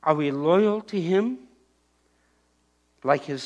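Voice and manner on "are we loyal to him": ordinary delivery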